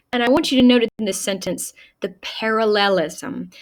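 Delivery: normal talking voice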